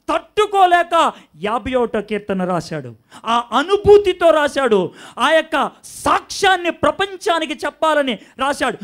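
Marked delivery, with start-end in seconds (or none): none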